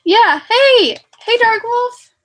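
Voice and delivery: high, loud happy hello; excited greeting. Real, not acted. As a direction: call out a cheerful hey. Greeting arriving friend excitedly.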